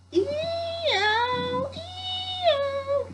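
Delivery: very high, squealed hee-haw; squeaky comic donkey bray; mock donkey bray. A narrator's reading. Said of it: "The donkey brayed".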